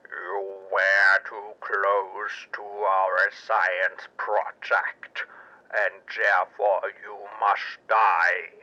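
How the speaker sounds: low, slow, alien menace; deep, dragged-out threat from a creature in disguise; slow low alien soldier threatening death; inhuman guard voice. A narrator's reading. Crab-like guard threatens Arch.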